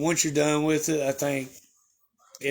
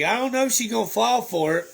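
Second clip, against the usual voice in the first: higher, mock-doubtful cousin; 7 semitones higher than usual. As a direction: sound unsure it will work. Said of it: "He's like".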